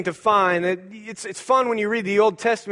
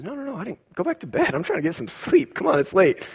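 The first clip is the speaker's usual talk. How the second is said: sleepy Eli; 8 semitones higher, faster than usual. Acted. Old priest Eli, woken at night, telling Samuel to go back to bed, sleepy and grumbling. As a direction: shoo the boy back to bed half asleep.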